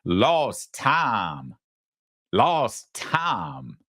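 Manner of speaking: drawn-out Southern "tahm" for "time"; mock Southern accent. Host mocking a politician's adopted accent, mock Southern accent. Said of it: "'Cause that's South Montreal".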